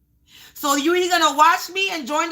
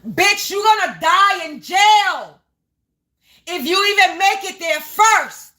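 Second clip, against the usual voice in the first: loud, raised-pitch furious outburst; angry yelling; 4 semitones higher, 4 dB louder, slower than usual. Real, not acted. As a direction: draw out the threat, yelling it. Angry rant at the landlord.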